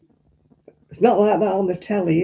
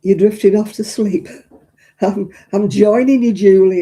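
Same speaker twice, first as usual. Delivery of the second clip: calling out; 3 semitones higher, 8 dB louder than usual. Real, not acted. Calling to sleepy Julie.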